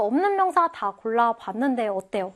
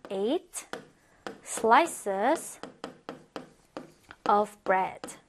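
"Eight ... slices ... of bread" word by word, long pauses between; slow dictation; 3 semitones lower, much slower than usual. Shown as a demonstration. Teacher writing out the answer for students, slow dictation.

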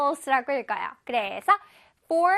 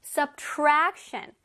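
"subtraction" stressed on the second syllable, tra; middle-syllable stress; much slower than usual. Shown as a demonstration. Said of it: "중간에 tra 부분에". ("subtraction"의 tra 부분에 강세)

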